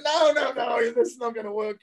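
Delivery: high wailed rejection; mock exasperated refusal. Producer stopping a terrible German audition, high-pitched mock dismay.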